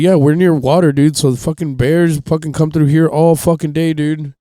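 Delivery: loud ranger. Retold or imitated. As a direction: lecture him loudly about bears. Ranger explains bears.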